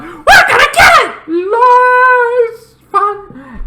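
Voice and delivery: high, loud whooping glee; gleeful shout. Retold or imitated. Characters finding Dean's stuff, gleeful high shout. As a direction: yell it like a kid grabbing loot.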